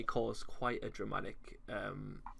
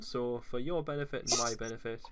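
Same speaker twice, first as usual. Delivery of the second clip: normal talking voice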